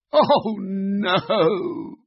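high, drawn-out groan of dismay. Acted. Tired Floppy asked to pull Kipper's sled, dismayed. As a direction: wail "oh no" in mock despair.

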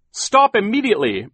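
high, loud command through a gate; ordering. A narrator's reading. Mister Poe calls through the gate, ordering. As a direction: shout an order at someone escaping. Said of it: "Mister Poe ordered".

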